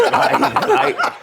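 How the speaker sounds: high-pitched laugh into right, right. Real, not acted. Laughing at own joke.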